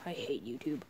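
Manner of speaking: whispered grumble under breath. Acted. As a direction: hiss it aside quietly. Muttered aside.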